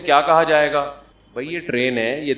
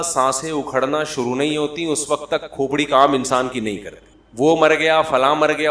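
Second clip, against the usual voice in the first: ordinary delivery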